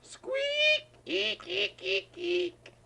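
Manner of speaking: tiny squeaking mouse voice, very high. Acted. Reader doing the mouse's squeaks for the child, very high.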